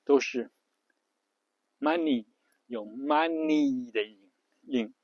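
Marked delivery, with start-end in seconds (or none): 1.8-3.9 "money" with a drawn-out EE ending; American money. Shown as a demonstration. Teacher showing American pronunciation, "money" ending long. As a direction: stretch the final Y of "money" into EE. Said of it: "美式发音都偏长".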